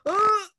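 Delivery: high, loud groan of disgust; a sharp mock-exasperated ugh. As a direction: let out a frustrated ugh. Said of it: "I was like".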